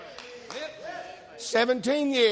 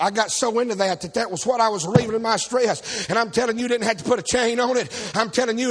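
ordinary talk, nothing marked

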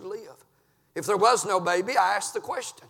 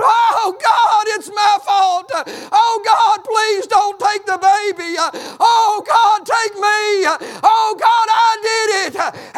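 loud, high, slow pleading wail; preacher voicing David's desperate prayer; begging David; 12 semitones higher, 10 dB louder, slower than usual. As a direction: beg God to spare the child. David begging God, voiced.

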